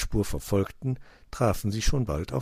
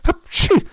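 very high, loud "hapschi" sneeze; acted sneeze; 12 dB louder than usual. A narrator's reading. Cat smelling fox, explosive sneeze. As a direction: burst into a storybook sneeze. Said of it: "nieste er".

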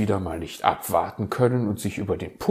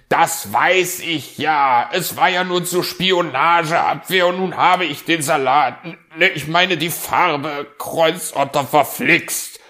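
louder, higher scolding at himself; grumbling fox; 9 semitones higher, 9 dB louder than usual. Acted. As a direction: curse your own mistake. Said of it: "schimpfte der Fuchs". (laut schimpfender Fuchs)

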